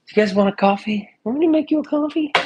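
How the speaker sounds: high, fast mock host voice; playful offer. Offering viewers coffee, in a high teasing voice.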